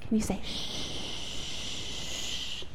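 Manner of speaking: slow whispered question; hushed whisper to children. Shown as a demonstration. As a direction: whisper it, drawn out. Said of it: "whisper the words really quietly".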